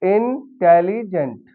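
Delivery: Intelligent said slowly, split into its three syllables; intelligent drawn out slowly, each syllable clear; slow syllable demo. Shown as a demonstration. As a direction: sound out intelligent syllable by syllable for the class. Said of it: "ये तीन syllable का word है".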